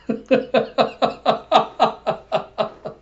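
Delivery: high, steady cackling giggle; real laughing fit. Real, not acted. After acting out a shocked reply, real giggling. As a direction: laugh helplessly at your own acting. Cracks up after own impression.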